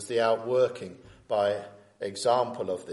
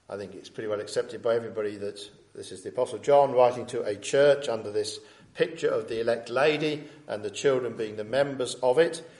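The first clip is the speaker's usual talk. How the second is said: normal talking voice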